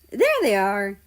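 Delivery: called out loud. Acted. Poland calling over to friends, loud. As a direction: call it across the hall.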